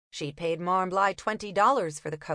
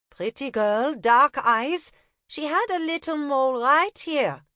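put-on higher, louder German-accented description; 7 semitones higher, 5 dB louder than usual. Una describing the woman in a German accent. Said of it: "feigning a German accent".

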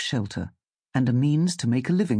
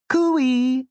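high, loud cooee; calling out; 12 semitones higher, 5 dB louder than usual. Acted. Tentacled creature waving to get Sam's attention, calling out. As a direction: trill out a long, high hailing call. It waves again.

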